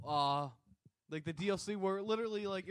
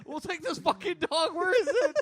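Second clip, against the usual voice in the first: laughing; 10 semitones higher, 8 dB louder than usual. Retold or imitated. Retold bravado, laughing.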